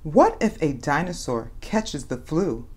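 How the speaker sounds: ordinary delivery, nothing marked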